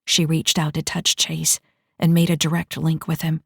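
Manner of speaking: ordinary delivery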